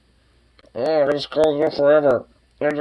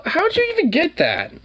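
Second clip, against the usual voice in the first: incredulous. Real, not acted. Dog grabbed something.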